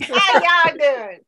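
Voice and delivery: hammed-up Texas howdy; Southern drawl. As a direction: stretch it out like a country greeting. Mock Southern greeting.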